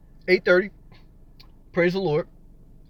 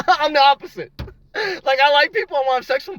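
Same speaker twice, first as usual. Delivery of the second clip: laughing; 10 semitones higher, 4 dB louder than usual. Real, not acted. Confessing to chat while laughing high.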